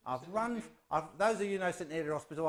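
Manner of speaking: normal talking voice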